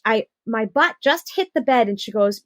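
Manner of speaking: ordinary talk, nothing marked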